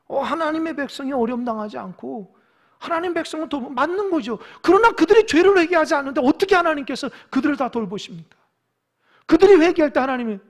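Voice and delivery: high, loud sermon argument; fervent preaching. Acted. Preacher challenging easy promises to the unrepentant, fervent preaching. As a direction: argue it hotly from the pulpit.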